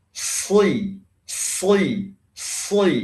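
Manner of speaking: SI thick and heavy with lips pulled sideways; thick emphatic shad. Shown as a demonstration. Teacher proving lips have no effect, thick SI. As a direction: spread the lips, keep SI thick. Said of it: "bisa juga ditarik ke samping begini".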